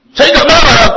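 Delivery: loud, high, indignant denial; angry husband denial. Retold or imitated. Husband snapping at his wife, loud angry denial. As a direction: bark it back, insisting you're calm. Husband denies anger loudly.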